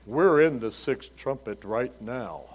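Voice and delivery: low, slow doom-laden pronouncement; funereal solemnity. Retold or imitated. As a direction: imitate a grim man announcing the end times. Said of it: "I feel like I'm in a funeral home".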